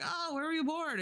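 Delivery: pitched high, bright question; high curious question. Retold or imitated. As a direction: ask it up high and eager. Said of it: "And she was like".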